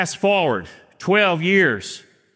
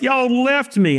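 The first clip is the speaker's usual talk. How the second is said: higher-pitched tearful child's complaint, imitated; mock bawling; 7 semitones higher than usual. Six-year-old to dad who left him at church, mock bawling. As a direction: imitate an upset little boy's wail. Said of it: "He is bawling his eyes out".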